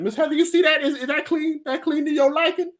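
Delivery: very high, eager-to-please voice; eager cleaner. Retold or imitated. Cleaner asking the boss for approval, high fawning voice.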